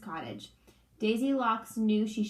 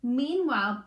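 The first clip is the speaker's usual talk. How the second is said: usual speaking voice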